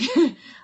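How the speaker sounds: a sudden high burst of laughter; short high laugh. Real, not acted. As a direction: laugh out at yourself mid-reading. Laughs at her own reading.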